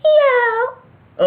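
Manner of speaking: very high meowing cat. Acted. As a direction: squeak it like a cat. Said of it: "her cat impression".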